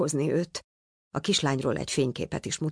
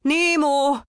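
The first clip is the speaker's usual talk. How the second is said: much higher, louder call; shouting his name; 11 semitones higher, 5 dB louder than usual. A narrator's reading. Father shouts after the departing boat, high and loud. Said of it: "kiabált Pizsi".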